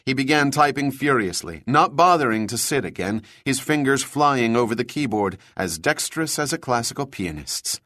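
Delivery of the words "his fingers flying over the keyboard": plain reading voice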